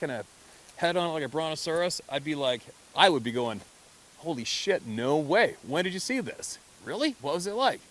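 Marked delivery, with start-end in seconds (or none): 4.2-7.8 high-pitched, wowed curiosity; excited amazement. Acted. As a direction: fire off eager questions, acting amazed. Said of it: "I wouldn't get angry".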